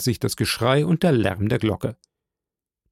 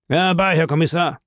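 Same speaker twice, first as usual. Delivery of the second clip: higher-pitched summoning call; 5 semitones higher than usual. A narrator's reading. Brigadier summons the police commissioner, calling out. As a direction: call him over briskly. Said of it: "rief der Brigadier".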